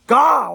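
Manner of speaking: loud, high frustrated sigh of God; fed up with himself, sighing; exasperated sigh. Real, not acted. Frustrated at own stammering.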